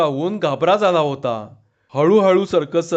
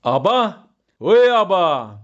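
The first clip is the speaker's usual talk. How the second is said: drawn-out hail, only mildly raised; calling out loudly; much slower than usual. A narrator's reading. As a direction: try to catch someone's attention from afar. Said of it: "त्याने पुन्हा मोठ्यांदा हाक मारली". (लांबून मारलेली हाक)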